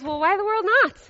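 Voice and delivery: high, surprised chicken voice. A narrator's reading. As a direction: squeak it out in disbelief. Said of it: "Chicken replies to Pig with surprise".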